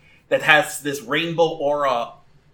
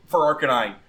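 normal talking voice

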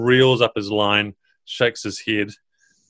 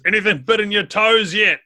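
much higher, squeaky little ogre voice; 11 semitones higher than usual. Acted. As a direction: pitch it way up like a small ogre.

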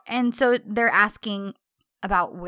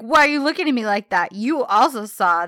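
indignant protest; 2 semitones higher, 6 dB louder than usual. Retold or imitated. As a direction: act out a raised-voice demand. Acted indignant protest to onlooker.

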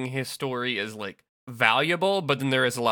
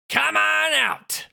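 shouting; 5 dB louder, much slower than usual. Acted. Villain calls out.

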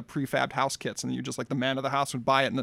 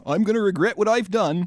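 louder, higher rustic British accent; Hagrid; 4 semitones higher, 4 dB louder than usual. Shown as a demonstration. Joking sequel for co-host, hammed-up Hagrid impression. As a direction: play it in a West Country voice. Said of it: "do a Hagrid".

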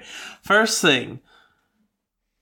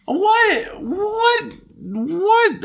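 incredulous; much slower than usual. Real, not acted. Incredulous repeated what.

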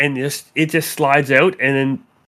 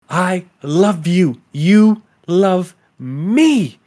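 slow obsessive declaration; 3 semitones higher, much slower than usual. Acted. GM voices obsessive girl's threat.

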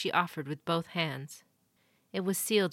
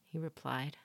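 ordinary delivery, nothing marked

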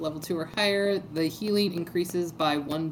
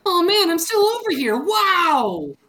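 excited shout; 9 semitones higher, 8 dB louder than usual. Acted. Player voices startled character.

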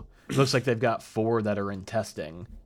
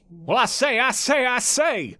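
put-on Southern gentleman accent; 13 semitones higher, slower than usual. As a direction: call out like a bombastic Southern gent. Foghorn-style Southern exclamation.

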